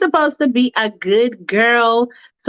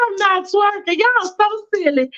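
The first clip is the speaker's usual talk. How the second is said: put-on much higher, girlish giggly protest; Faith's voice; 9 semitones higher than usual. Faith brushing off her friends, high and bashful. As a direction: play it coy and bashful, pitched way up. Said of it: "Faith is like".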